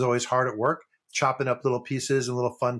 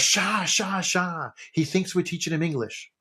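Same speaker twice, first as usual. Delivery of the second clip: put-on higher-pitched, hushing Yiddish accent; 6 semitones higher, slower than usual. Horowitz shushing Schwartz with the punchline, in a Yiddish accent. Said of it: "I'm gonna do the fucking accent".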